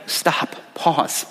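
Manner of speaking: hushed, soft stop and pause; quiet stop. Shown as a demonstration. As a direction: say it softly, like pressing pause. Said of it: "The first one is to stop".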